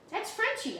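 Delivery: put-on squeaky high child voice proudly naming the dog; high excited child. Girl introducing her dog to Robert, high and bright. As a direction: chirp the dog's name up high.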